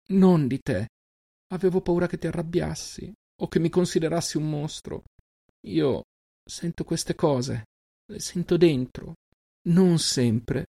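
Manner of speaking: put-on high, hesitant girl's voice, halting; a scared little sister groping for words; young sister's voice. A narrator's reading. Selin explaining her gift to her brother, high and hesitant.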